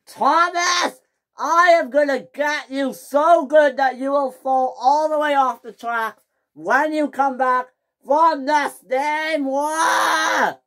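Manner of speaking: high, loud, crying shout; furious threat. Acted. James swearing revenge on Thomas, shouting furiously. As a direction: bellow the threat high and hard. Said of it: "James was the maddest engine on Sodor".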